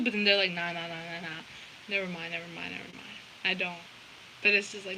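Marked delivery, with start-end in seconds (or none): 0.5-3.8 low, quiet backpedalling; soft, dismissive second thoughts, acted; muttered retreat. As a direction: mumble it low, taking it all back. Acts out their backing off.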